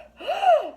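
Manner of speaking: high shocked gasp; shocked group gasp. A narrator's reading. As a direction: gasp in horror. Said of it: "They all gasped".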